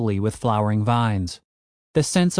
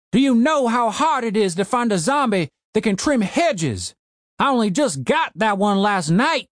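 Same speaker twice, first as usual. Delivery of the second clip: put-on shouting old man; 9 semitones higher than usual. A narrator's reading. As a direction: bellow at the intruders like a furious old man. Old man ranting about zombie.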